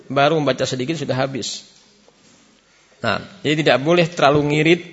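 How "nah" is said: ordinary talk, nothing marked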